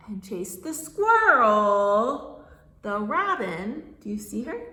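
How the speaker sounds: animated storytime narration with big swells; reading to children. Acted. Reader telling a farm story to small children, animated storytime voice. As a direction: stretch out key words for little listeners.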